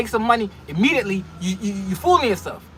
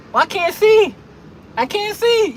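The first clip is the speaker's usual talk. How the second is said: high-pitched mock panicked driver; exaggerated high cry of alarm; 9 semitones higher, 3 dB louder, slower than usual. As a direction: wail it like a blinded driver. Acting panicked drivers in fog.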